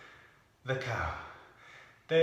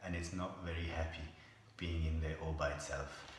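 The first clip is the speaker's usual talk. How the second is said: sad story voice; 6 semitones lower, 8 dB quieter than usual. Acted. Sad lonely donkey story.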